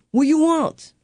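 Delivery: put-on high-pitched toddler with an Italian accent; little Italian accent. Mother imitating her barely speaking son, little Italian accent. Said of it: "Talks like a, you know, a, a little, uh, Italian accent".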